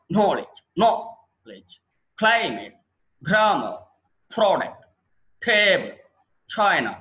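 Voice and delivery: each word punched on its first syllable, knowledge as NO-ledge; stress on first syllable. Shown as a demonstration. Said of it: "ఇప్పుడు దాన్ని ఎలా పలకాలి మనం". (మొదటి అక్షరంపై ఒత్తి)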